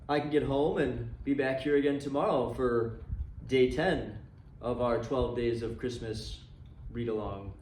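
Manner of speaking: usual speaking voice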